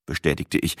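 plain reading voice

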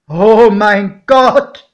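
slow, high, very loud exclamation; calling out aghast. A narrator's reading. Godfather seeing the train and its passengers, crying out aghast. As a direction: cry it out, long drawn and loud. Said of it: "rief mein Pate".

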